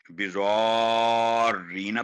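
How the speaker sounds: slow, with the sounds in बिज़ौरीना stretched; long elongation. Shown as a demonstration. Teacher shows students the long madd. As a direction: hold the long vowel for counts. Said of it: "four to six second आवाज लंबी होगी".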